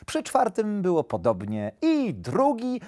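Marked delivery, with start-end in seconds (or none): 1.8-2.8 put-on high, slow childlike hare voice; high, stretched-out little voice; little hare counting. A narrator's reading. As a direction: count it off with naive delight. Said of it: "wyliczał zajączek".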